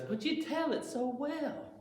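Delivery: put-on high, soft old lady. The old lady on the bench, soft and high. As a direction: lightly voice a sweet old lady. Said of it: "the old lady says".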